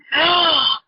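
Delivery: sudden audible intake of breath; short breathy gasp; sharp gasp. Acted. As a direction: gasp as if struck by a realisation. Client's sudden realisation, acted out.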